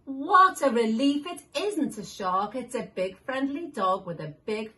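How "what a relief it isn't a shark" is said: ordinary delivery, nothing marked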